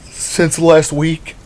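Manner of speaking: reasoning. Acted. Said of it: "tried to reason".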